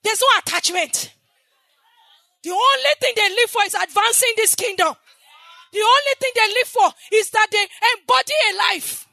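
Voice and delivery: loud, high, full-force preaching; fiery preaching. Acted. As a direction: preach it out to the whole church.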